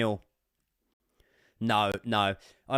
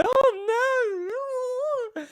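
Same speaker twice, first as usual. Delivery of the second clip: mocking doubters; 5 dB louder than usual. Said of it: "people that were saying to me".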